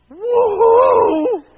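loud, high whoop of joy; joyful whoop. Acted. Pastor whooping with joy at Eden's wedding. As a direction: cheer it out like a delighted groom.

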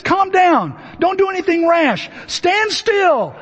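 high, firm calming command; lightly voiced Moses telling them to stand still. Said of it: "Moses says".

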